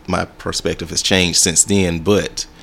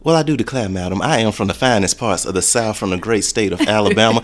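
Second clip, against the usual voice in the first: southern belle. Pretend. Mock southern belle greeting to host.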